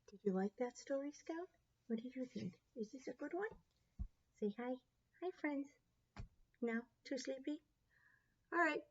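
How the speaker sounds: soft, gentle talk to the pet. Real, not acted. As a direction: coo softly to the sleepy pup. Talking to the dog.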